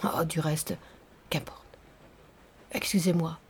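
low, hushed apology; subdued retreat. A narrator's reading. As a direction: back off quietly after the outburst. Narrator breaks off his outburst, apologises.

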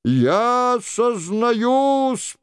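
high, very drawn-out confession; imitating the king. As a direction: wail out a slow confession as the king. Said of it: "продолжал за Генриха Шико".

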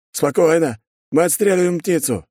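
high-pitched guard reporting in; radio report. A narrator's reading. Guard radios the post to prevent alarm, reporting. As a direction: announce it briskly to the post. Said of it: "сразу сообщил он по рации".

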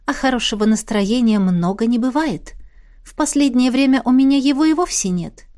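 plain reading voice

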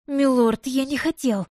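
mild frightened plea to his master; pleading. Acted. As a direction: beg the lord to believe you. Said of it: "продолжал кляться Лонка".

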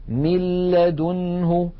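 من لدنه recited slowly as "mil-ladunhu", straight onto a doubled l; doubled lam, no ghunna. Shown as a demonstration. Teacher showing merging without ghunna, doubled l in من لدنه. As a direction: drop the n of من and lean on the l of لدنه, no nasal hum. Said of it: "ولم يبق من الغنة شيء".

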